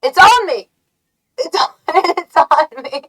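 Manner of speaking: high, loud, half-laughing panic. Real, not acted. A moth lands on her, laughing panicked squeal. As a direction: squeal that it's on you.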